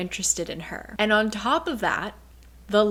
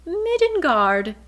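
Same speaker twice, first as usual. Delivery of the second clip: "Midgard" called out high and loud in glee; light up at a familiar name; delighted recognition; 8 semitones higher, 5 dB louder than usual. Retold or imitated. Bryce strangely comforted by Midgard.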